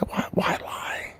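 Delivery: unvoiced, slow whisper. Real, not acted. Comic asking the audience why lie, whispered. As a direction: breathe it as a hushed aside.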